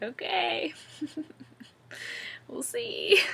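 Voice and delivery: high, doubtful singsong with a laugh; amused skepticism. Retold or imitated. Patient answering doctor's easy promise, laughingly skeptical. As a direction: humor them while plainly doubting it.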